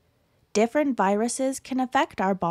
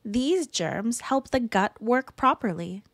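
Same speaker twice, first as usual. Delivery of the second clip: usual speaking voice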